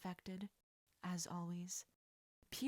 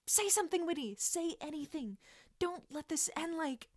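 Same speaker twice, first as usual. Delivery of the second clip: panicked; 8 semitones higher, 3 dB louder than usual. Acted. Panicked inner plea.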